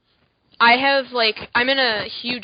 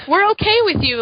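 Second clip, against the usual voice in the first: high, loud mock approval; lightly voiced cheerful adult; 10 semitones higher, 6 dB louder than usual. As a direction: parody permissive grown-ups. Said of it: "mocking the adults".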